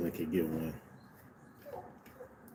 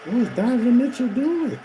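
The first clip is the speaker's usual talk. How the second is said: incredulous outburst; 11 semitones higher than usual. Real, not acted. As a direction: react to a baffling turnover, voice shooting up. Disbelief at a bad turnover.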